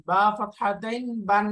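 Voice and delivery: বা ফাতহাতাইন বান, ending in a clear n; spelling-out recitation. Shown as a demonstration. Teacher showing the nun in tanween, spelling-out recitation of বা ফাতহাতাইন বান. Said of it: "এরকম উচ্চারণ হয়ে যাচ্ছে".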